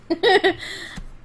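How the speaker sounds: short high-pitched chuckle; amused giggle. Real, not acted. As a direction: let out a light giggle. Laughs at skipping the known plot.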